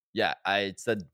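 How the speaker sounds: ordinary talk, nothing marked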